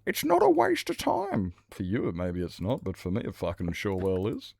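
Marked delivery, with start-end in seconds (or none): 0.1-1.5 very high squeaky mock retort. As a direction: parody the nagging objection. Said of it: "mocking the doctor advice".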